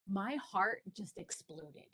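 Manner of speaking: usual speaking voice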